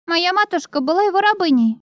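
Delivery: put-on high, quick small girl; little girl voice. A narrator's reading. Child voice.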